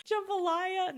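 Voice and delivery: high, laughing repeat of jambalaya; incredulous giggle on jambalaya; laughing question at her own slip; amused disbelief. Real, not acted.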